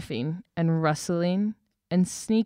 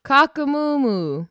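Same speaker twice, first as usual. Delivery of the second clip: high, loud, slow rooster-style crow; a big silly crow, drawn out; shouted crow; 6 semitones higher, 7 dB louder, slower than usual. Acted. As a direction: belt it out like a proud cockerel greeting the sun. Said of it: "he took a deep breath and shouted".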